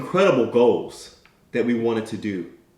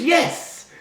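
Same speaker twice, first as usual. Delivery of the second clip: triumphant yes. Retold or imitated. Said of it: "Little Timmy says".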